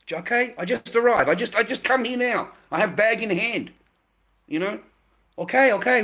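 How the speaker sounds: mock simpering appeasement of an angry wife; simping. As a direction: grovel to calm her down, hands up. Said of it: "really simping".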